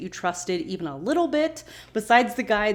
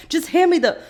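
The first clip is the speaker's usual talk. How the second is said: louder, higher snapped command; impatient order; 9 semitones higher, 8 dB louder than usual. Retold or imitated. Jack orders Hurley during surgery, loud and impatient. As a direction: bark the order under pressure. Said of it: "And Jack is like".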